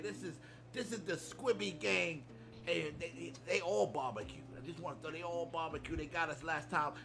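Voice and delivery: ordinary talk, nothing marked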